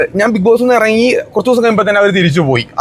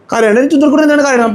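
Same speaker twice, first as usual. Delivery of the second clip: high, tender cooing; 7 semitones higher than usual. Real, not acted. Uncle telling his darling not to cry, soothing baby talk.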